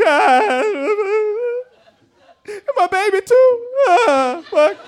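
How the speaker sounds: high, loud hammed-up bawling; fake crying. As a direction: overdo the sobs, obviously faked. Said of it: "had to fake cry".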